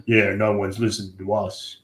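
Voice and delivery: put-on low, unhurried old-timer's voice; old grandpa voice. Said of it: "it said it in this voice".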